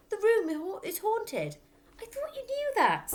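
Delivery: Matilda acting scared; mock fright.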